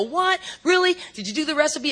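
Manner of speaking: high-pitched incredulous questioning; exaggerated innocent astonishment; mock disbelief. Acts out querying the newcomer.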